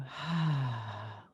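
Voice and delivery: long audible sigh out through the open mouth; dramatic sigh. Shown as a demonstration. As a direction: let out a big, slow demonstration sigh. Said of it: "big sigh out".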